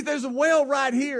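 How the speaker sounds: high-pitched imitation of the owner; insistent warning. Owner tells driver about the well, retold insistence.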